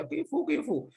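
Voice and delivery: high tearful plea; crying out. Acted. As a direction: wail it through tears. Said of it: "sambil menangis gitu ya". (rintihan sambil menangis)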